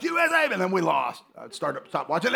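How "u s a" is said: high cheering chant; high fan cheer. Retold or imitated. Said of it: "I was like".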